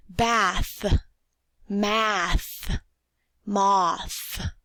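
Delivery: the final th of "Bath", "math", "moth" stretched and clear; model words for repeating. Shown as a demonstration. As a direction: lean on the th at the end of each word. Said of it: "is the sound we hear in the final position".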